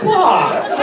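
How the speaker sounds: ordinary delivery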